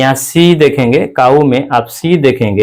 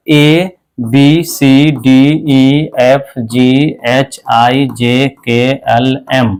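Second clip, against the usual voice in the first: slow alphabet recital; much slower than usual. Shown as a demonstration. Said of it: "अगर बोलते हैं ना अंग्रेजी पढ़ते समय".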